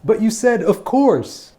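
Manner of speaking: high, loud, indignant sibling; whiny protest. Acted. Sibling objects after being refused the car, loud and whiny. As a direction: protest like a cheated younger sibling.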